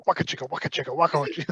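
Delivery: chugging wah-wah guitar imitation; vocal mimicry of seventies music; imitated funk riff. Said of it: "your mind is going".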